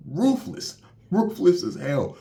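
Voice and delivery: high, loud outraged cry of ruthless; outraged exclaim. Real, not acted. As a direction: shout it in disbelief at the cruelty. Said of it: "reacting to the monster's cruelty".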